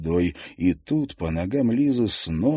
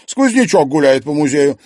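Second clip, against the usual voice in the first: ordinary delivery, nothing marked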